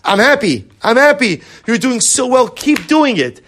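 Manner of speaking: high, loud, sing-song cheering; cheery voice of the world. Retold or imitated. Teacher voicing the world's empty praise, high and loud cheer. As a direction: egg yourself on like a pushy cheerleader.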